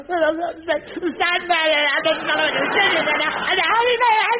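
put-on slow, high, wobbling child voice; frightened child. Boy telling his mother about the Sandman, slow and scared. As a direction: drag it out like a terrified boy. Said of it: "I'm going".

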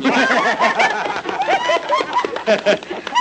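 big loud belly laugh; loud burst of laughter. Real, not acted. Host cracking up loudly at the punchline. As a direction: howl with laughter at the joke.

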